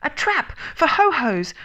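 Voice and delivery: high, loud, strained outburst; flustered little creature, high and forceful; husky. A narrator's reading. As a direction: blurt it with a catch in the throat. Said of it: "said Piglet huskily".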